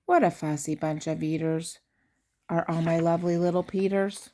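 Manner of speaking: ordinary delivery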